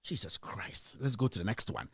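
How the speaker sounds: low, very soft, spent; quiet dismay. Real, not acted. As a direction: drop to a weary near-murmur. Dismayed, moves to next story.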